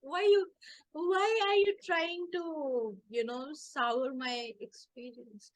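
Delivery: high, slow, drawn-out mock protest; mock aggrieved complaint. Sister asks brother why he spoils it, mock aggrieved. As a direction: stretch it out like a hammed-up sulk.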